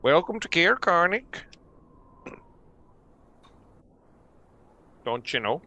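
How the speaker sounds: put-on friendly guard with a light Minnesota accent; lightly voiced Minnesota town guard. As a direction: greet them the Minnesota way, "Don't you know?". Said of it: "Caer Konig speaks in a Minnesota accent".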